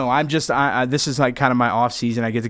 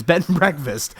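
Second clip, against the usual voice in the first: ordinary delivery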